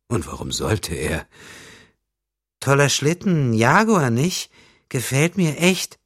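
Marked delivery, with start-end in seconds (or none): 2.6-6.0 put-on high, bright-eyed girlish voice, much louder; fluting young girl. A narrator's reading. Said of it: "flötete die Kleine". (hohe, flötende Mädchenstimme)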